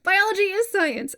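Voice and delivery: high, loud joking exclamation; playful exclaim. Real, not acted. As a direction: blurt it out with a laugh. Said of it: "Okay, I'm shutting up now".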